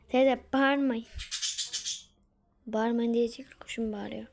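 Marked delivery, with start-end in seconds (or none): 0.1-1.1 calling out. Real, not acted. Calls to aunt to stop shouting.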